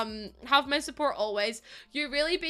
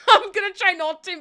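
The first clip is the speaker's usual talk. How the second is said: laughing while fighting tears; choked-up laugh breaking into higher, louder words; close to crying; 8 semitones higher, 10 dB louder than usual. Real, not acted. Streamer thanking viewers for their time, close to crying. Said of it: "I'm gonna cry".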